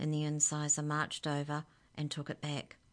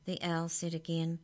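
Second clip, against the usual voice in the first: usual speaking voice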